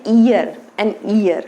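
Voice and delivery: ईयर said very slowly, clear ee start; drawn-out ईयर, an ear for learners; slow demo. Shown as a demonstration. Teacher shows pronunciation of ear, slowly. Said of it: "इसका pronunciation क्या होता है".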